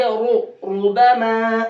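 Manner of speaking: "रुबमा" with full R and long "aa"; heavy Ra. Shown as a demonstration. Teacher answering how to read it, heavy Ra. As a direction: round the R, stretch the end of "रुबमा". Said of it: "कैसे पढ़ेंगे?".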